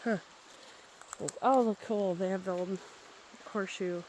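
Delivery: normal talking voice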